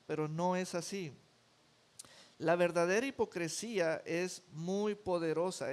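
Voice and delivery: ordinary talk, nothing marked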